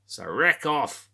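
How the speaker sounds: high, loud, drawn-out call; playful Aussie send-off. Pretend. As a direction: holler it slowly as a cheeky Australian dismissal. Aussie catchphrase sign-off, called out.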